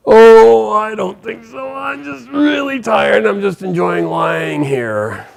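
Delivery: high, slow, drawn-out lazy creature voice; tired old monkey. Acted. Old monkey refusing to leave the trail, tired and lazy. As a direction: groan it out high and long, too weary to budge. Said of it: "clearly an older monkey".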